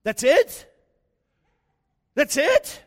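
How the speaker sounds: can't believe that's all there was; incredulous. Retold or imitated. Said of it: "And I thought".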